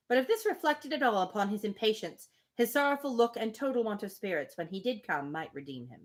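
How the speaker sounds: plain reading voice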